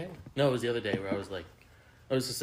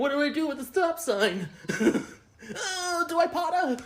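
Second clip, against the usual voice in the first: much higher, laughing confused voice; lost girl voice; 3 dB louder than usual. Pretend. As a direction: play a giggly lost tourist. Mocking the lost traveller.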